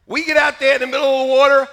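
ordinary talk, nothing marked

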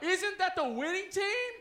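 loud call-and-response chant; cheerleader shout. Shown as a demonstration. Playing cheerleader for the audience to answer, loud chant. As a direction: shout a cheer to rouse the crowd. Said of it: "I'll be the cheerleader".

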